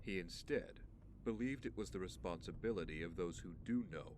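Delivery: soft, measured recollection; quiet confiding. Acted. Man shares what his father once told him, quiet confiding.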